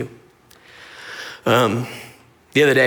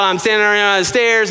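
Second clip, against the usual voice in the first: high mock-mother voice; mimicked mom; 7 semitones higher, 3 dB louder than usual. Mom explains stairs to frustrated son, high mocking imitation. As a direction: parody mom's muddled explaining, pitched up.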